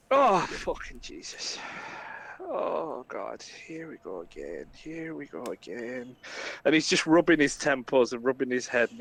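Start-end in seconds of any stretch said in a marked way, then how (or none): 0.1-6.1 low, soft groan of resignation; slow down and sigh into despair; Flynn, weary. Acted. Flynn groans wearily after drinking.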